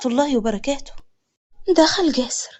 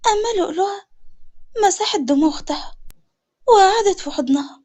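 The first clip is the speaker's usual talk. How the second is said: ordinary delivery, nothing marked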